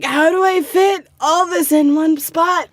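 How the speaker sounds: high, loud mock-frustrated outburst; overwhelmed student wail, played up; exasperated learner. Learner facing tiny boxes for complex characters, mock exasperation.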